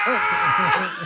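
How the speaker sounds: high, loud comic scream; imitated shriek of shock; mock horrified scream. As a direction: scream in hammed-up horror. Retold horror at the birth.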